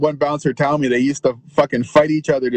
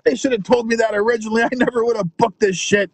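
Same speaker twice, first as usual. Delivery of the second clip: mock furious rant; 6 semitones higher than usual. Bar owner realizes no beer sales.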